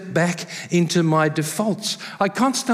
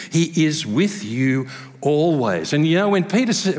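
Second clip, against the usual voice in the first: normal talking voice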